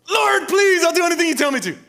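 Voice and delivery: high, loud begging cry; desperate pleading. Retold or imitated. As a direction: beg like you're going under. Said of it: "Have you ever said that before?".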